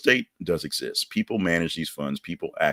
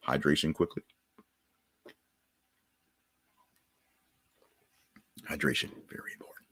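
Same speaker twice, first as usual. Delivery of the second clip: 1 whisper; 2 semitones lower, 9 dB quieter, faster than usual. Real, not acted. Aside while drinking water.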